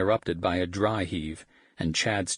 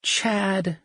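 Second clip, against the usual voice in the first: a much higher, drawn-out whine of his name; whining; 14 semitones higher, 4 dB louder than usual. Acted. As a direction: whine it in disgust. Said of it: "she whined".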